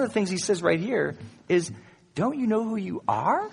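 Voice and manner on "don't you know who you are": high, incredulous question rising at the end; preacher voicing an apostle's reproach; challenging question. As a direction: challenge them with a lifted voice. Voices Paul challenging the church.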